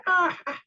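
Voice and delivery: very high nasal-free bleat of a ram. Retold or imitated. Shlomo clowns a ram on the nose, high bleat. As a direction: squeak out a ram cry. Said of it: "on the nose".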